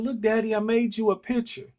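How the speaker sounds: put-on high, loud excited toddler calling Daddy; little son's voice. Toddler runs to his dad at daycare, high excited child voice. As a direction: squeal it like a small boy greeting his dad. Son shows his picture.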